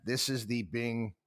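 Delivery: usual speaking voice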